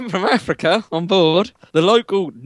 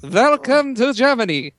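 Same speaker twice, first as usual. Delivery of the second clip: lightly put-on German accent, higher pitched; mock German accent; 7 semitones higher than usual. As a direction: play the German bus driver, accent a bit hammed-up. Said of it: "'Cause you went from Germany with that accent".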